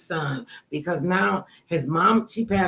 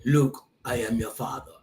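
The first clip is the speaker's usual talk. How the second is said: deep movie-villain impression; James Earl Jones; 7 semitones lower, 3 dB quieter than usual. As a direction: drop low for a famous film line. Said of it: "I coulda did that voice".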